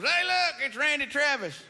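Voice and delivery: loud, high mocking call across the street; heckling shout. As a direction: yell it at him to get a rise. Stranger heckling outside bar.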